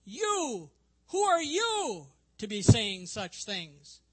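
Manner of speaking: loud, high, incredulous challenge with YOU leaned on; rejecting challenge. Acted. Religious leaders challenging Jesus, rejecting and scornful. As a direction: demand it scornfully, voice jumping up. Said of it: "asking it in a sort of rejecting way".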